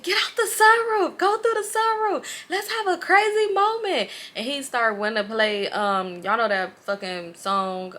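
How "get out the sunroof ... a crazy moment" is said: hyped up. Retold or imitated. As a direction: pump them up, high. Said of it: "He said".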